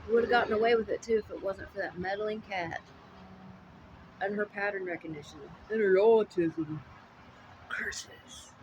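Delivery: hammy defeated cartoon villain; mustache twirling villain. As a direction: shake your fist and curse, foiled again.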